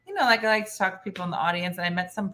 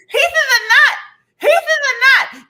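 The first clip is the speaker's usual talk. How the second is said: very high, loud screamed warning; shrill scream; 10 dB louder than usual. Retold or imitated. Allergic friend screaming at her about the nut. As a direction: scream it with a swelling tongue. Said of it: "She goes".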